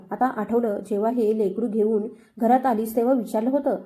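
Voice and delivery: ordinary delivery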